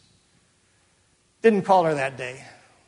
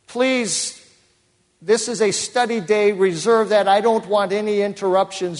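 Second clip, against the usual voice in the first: louder, raised voice for a no-nonsense secretary; firm instruction; 6 semitones higher, 6 dB louder than usual. Retold or imitated. Pastor voicing his secretary's strict order, loud and firm. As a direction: lay down the rule, loud and firm.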